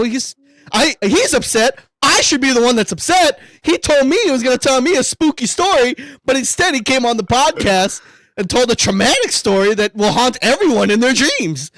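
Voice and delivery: high, worked-up retold protest; hammed-up outraged complaint; mock indignation.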